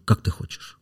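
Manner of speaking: soft whispered bedroom question; intimate question. Retold or imitated. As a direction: whisper it as if to a lover. Quotes himself asking a girl softly. (интимным шёпотом)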